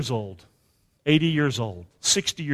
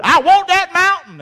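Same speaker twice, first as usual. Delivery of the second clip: eager claim; 10 semitones higher, 6 dB louder than usual. Acted. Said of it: "Joshua and Caleb said".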